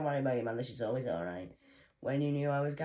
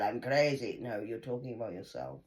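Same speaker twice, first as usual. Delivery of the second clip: ordinary talk, nothing marked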